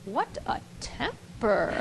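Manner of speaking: low, soft, slow inner thought; muttered thought. Retold or imitated. Wife thinking about her husband's temper, quiet. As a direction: think it under your breath. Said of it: "thinks to herself".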